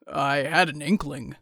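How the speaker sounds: low, slow rumbling giant; deep big-man voice. A narrator's reading. Bull's deep character voice.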